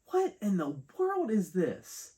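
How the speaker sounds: high, drawn-out incredulous question; baffled disbelief. Real, not acted. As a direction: raise the pitch and stretch it out in disbelief. Baffled by the toy packaging.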